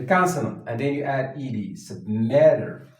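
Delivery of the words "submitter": ordinary talk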